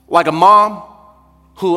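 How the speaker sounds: ordinary delivery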